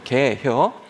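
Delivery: two-syllable Chungcheong-accented punchline; Chungcheong dialect. Shown as a demonstration. Preacher landing the final dialect joke, Chungcheong accent. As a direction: ask it like a Chungcheong local. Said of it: "충청도".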